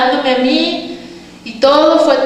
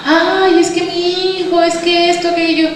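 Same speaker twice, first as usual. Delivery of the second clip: higher-pitched imitation of a moaner; mock complaining; 5 semitones higher than usual.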